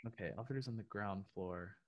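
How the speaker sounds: very soft, thinking aloud; quiet, absorbed read-back; reading to oneself. Real, not acted. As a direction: go back over it under your breath. Rereading the riddle to himself.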